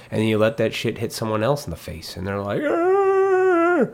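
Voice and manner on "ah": hammed-up high-pitched wail, loud; mock wail. Mimicking someone hit in the face, high mock wail. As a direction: put on a high, loud mocking voice.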